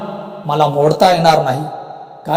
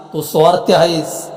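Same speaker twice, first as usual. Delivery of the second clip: ordinary delivery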